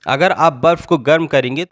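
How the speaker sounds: ordinary delivery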